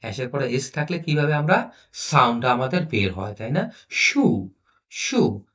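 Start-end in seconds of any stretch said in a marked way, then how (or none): 3.9-5.5 শু said separately with a clear sh; pronunciation demo. Shown as a demonstration. Teacher contrasting two sounds of s, pronunciation demo of শু. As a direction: model শু with sh, pausing after each. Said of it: "উচ্চারণ করতেছি".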